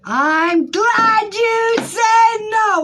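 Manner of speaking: very high, drawn-out squealing yell; exultant shout. Real, not acted. Reader hears client's no, shrieking with excitement. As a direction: belt it out slowly, word by word.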